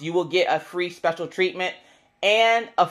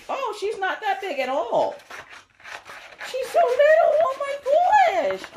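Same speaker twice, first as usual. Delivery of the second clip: very high excited squeal; real squeaky gushing, way up high. Real, not acted. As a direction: squeal in delight, stretching the last words. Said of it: "my voice is really squeaky today".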